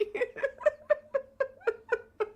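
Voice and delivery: very high, rapid giggles; real laugh that keeps going; helpless giggle. Real, not acted. Streamer after confessing the price, giggling helplessly.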